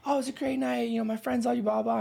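chirpy retelling of her praise; mild imitation of a happy date; a hint of the date. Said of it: "of course, she's like".

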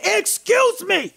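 high, drawn-out call across the street; retold yell at the truck crew; shouted excuse me. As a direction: yell it to stop the workers. Yelling at concrete truck workers.

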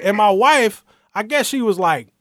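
ordinary talk, nothing marked

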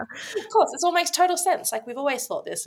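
high, loud imitation, easing down; cousin's breezy certainty. Imitating cousin saying the family always knew, high and emphatic. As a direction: act out someone stating the blindingly obvious. Retells cousin's obvious-to-us answer.

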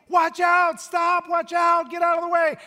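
high, loud warning yell; frantic shout. Retold or imitated. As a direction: scream a warning at someone in the road. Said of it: "flailing and shouting".

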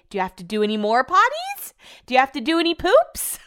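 high, rising puppy-talk questions; excited pet-owner voice; dog talk. Retold or imitated. Retold questions to her dog.

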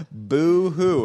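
hammed-up fake boo-hoo, high and loud; mock crying. Host mocks Morgan Freeman's Russia ban with a fake boo-hoo. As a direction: sob in mock pity, teasing. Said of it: "he's not sad about this".

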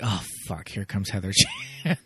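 low, muttered mock groan of dread; quietly fed-up aside, imitating classmates; retold weary dread. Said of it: "even they hate Heather".

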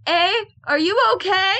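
high, loud mock policeman's check; calling out. Imitating ad's policeman at crash, loud call. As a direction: imitate an officer calling into a car. Acts police officer at car door.

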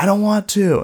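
high, loud mock refusal; reluctant protest. Girlfriend refusing to climb the waterfall, reluctant protest. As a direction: imitate someone calling back a protest.

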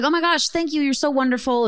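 high, gushing imitation of gratitude; retold effusive thank-you. Acted grateful owner in retold story.

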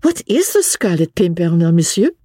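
tickled and curious, lightly amused; merry amusement. A narrator's reading. Young Frenchwoman hears a droll name, merry amusement. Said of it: "with a merry laugh".

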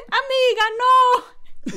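high, loud mock horror; shrieked, scandalized plea; panicked exclamation. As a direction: cry out mortified at being caught. Acts out Omar's shocked plea.

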